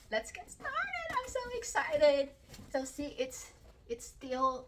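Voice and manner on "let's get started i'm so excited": high, squealing excitement before the unboxing; excited squeal. Real, not acted. Vlogger squealing to viewers before the unboxing, high and excited. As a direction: squeal it out like you can't wait.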